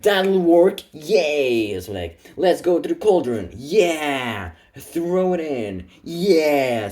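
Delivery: high, loud gleeful whoops; excited cheering. Acted. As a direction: cheer like a giddy cultist. Cultists cheer over the DNA.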